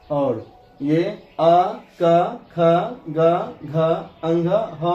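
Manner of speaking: teacher recites the letters aloud; slow letter recital. Shown as a demonstration. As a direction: drill the letters slowly for pupils. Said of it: "और विसर्ग के उच्चारण में".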